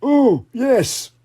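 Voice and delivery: high sheepish Daddy Pig; a bit embarrassed, high voice; lightly embarrassed character voice. Acted. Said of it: "a little embarrassed".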